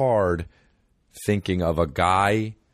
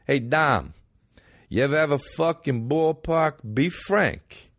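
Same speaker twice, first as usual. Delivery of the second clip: put-on New York accent; 8 semitones higher, slower than usual. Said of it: "people are gonna be saying in New York".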